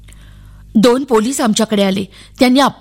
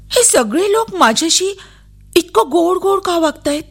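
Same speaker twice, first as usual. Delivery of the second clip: high, wondering questions; puzzled child; 7 semitones higher than usual. A narrator's reading. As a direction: wonder aloud in a higher voice. Child wonders why all are kind. (गोंधळलेल्या मुलीचा उंच आवाज)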